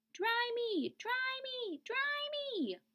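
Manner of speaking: very high, whiny chick voice; high needy chick cry; soggy chick pleading. Acted. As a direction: beg to be dried as the tiny chick. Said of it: "cried the soggy baby chick".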